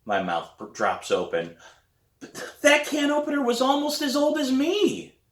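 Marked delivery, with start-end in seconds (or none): 2.2-5.2 put-on high, whining kid's protest; whiny protest. A narrator's reading. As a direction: protest to Dad in a much higher whine. Protesting to dad.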